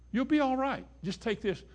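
a hint of a breezy doctor; doctor reassuring. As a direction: brush the patient off lightly. Doctor voiced in sermon skit.

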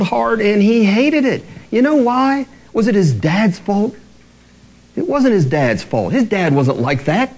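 ordinary talk

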